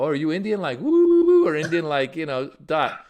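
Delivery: tipsy teasing question with high, loud mock whoops; joking. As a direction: tease playfully, whooping high on the woos. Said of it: "I jokingly, I was like".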